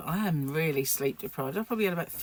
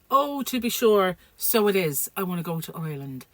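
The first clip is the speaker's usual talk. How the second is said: bad Irish accent; 6 dB louder than usual. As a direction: put on a poor Irish lilt. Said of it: "I'm sorry, that's a bad accent".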